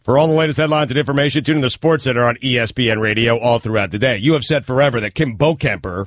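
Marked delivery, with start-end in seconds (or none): 0.0-4.2 quick promo tag; news read. Acted. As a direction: rattle off the promo.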